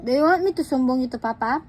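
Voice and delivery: ordinary talk, nothing marked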